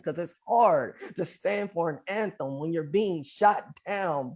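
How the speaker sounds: ordinary delivery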